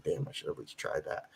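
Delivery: whispered under the breath; hushed self-reproach; whispered regret. Retold or imitated. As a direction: mutter it as a rueful old man. Imagined regret in old age.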